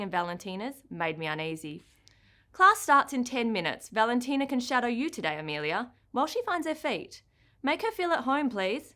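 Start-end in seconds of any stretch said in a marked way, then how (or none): 2.5-8.9 higher, lively ballet teacher; kind, brisk dance teacher; bright teacher voice. Acted. Teacher Miss Lily speaking.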